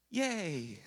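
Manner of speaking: flat, half-hearted mock cheer; a deflated, unenthusiastic yay; sarcastic yay. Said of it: "And then you're like".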